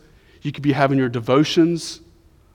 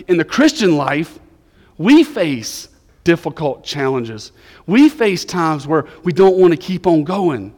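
loud, raised, emphatic sermon delivery; forceful preaching; 4 semitones higher, 8 dB louder than usual. Real, not acted. Preacher urging the church through hard times, loud and emphatic. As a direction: drive it home to the congregation at volume. Preacher drives the point home.

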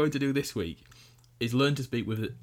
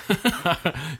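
loud, higher-pitched laugh; 6 semitones higher, 5 dB louder than usual. Real, not acted. Co-host reacting to the rogue joke, laughing out loud. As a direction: burst out laughing. Joking after "Don't go rogue.

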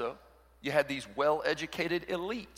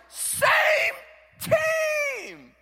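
very high, loud coach's shout; coach yelling; 7 dB louder than usual. Retold or imitated. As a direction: yell across the field at the kids. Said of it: "the coach will yell".

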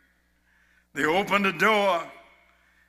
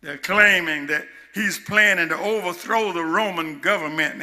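normal talking voice

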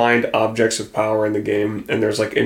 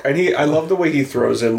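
usual speaking voice

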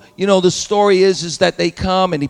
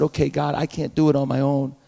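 resigned prayer; 5 semitones lower, 6 dB quieter than usual. Pretend. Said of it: "Joseph got up and said".

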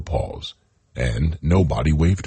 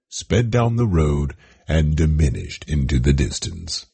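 ordinary delivery, nothing marked

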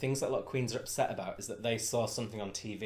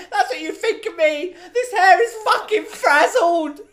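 screeching high, loud rant with a broad northern edge; G C impression; 13 dB louder than usual. A meltdown to Darren, screeched high and loud. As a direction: wail it out like a meltdown in a tiny room. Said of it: "my G C impression".

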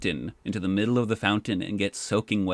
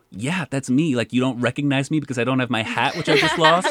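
louder, laughing through the words; laughing disbelief; 4 semitones higher, 6 dB louder than usual. Retold or imitated. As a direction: laugh at the absurdity as you say it. Amused disbelief at not being recognized.

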